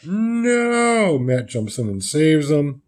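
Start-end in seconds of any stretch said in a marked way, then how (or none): 0.0-1.2 2 high, strained cry of alarm; 3 yelp in panic; 4 a high, voiced-up cry; 1 mock cry. Someone jumps in to save boy.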